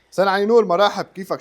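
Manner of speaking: loud, bright hello to a viewer; excited greeting. Real, not acted. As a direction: call out the greeting with a burst of energy.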